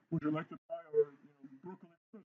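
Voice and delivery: put-on lightly French-accented waiter, very soft; mock French-accented restaurant server, hushed. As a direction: offer it softly with a hint of French accent. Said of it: "French accent on that one".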